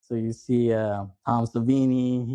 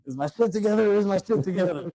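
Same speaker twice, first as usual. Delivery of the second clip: high, lightly voiced dazed repeat; quoting the infamous line; 8 semitones higher than usual. Quoting Seth's famous line to a friend, lightly voiced. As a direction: ask it twice like a stunned man. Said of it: "he says the infamous line".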